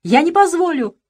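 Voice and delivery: high, loud stamping shout on позволю; desperate shout. Acted. Boy keeps shouting, stamping in despair. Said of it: "продолжал кричать Иван".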